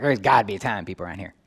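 ordinary talk

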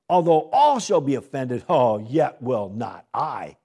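loud, slow, proud vow; Peter boasting. Acted. Preacher acting Peter's loud, boastful promise. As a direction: declare it proudly, drawing out not I.

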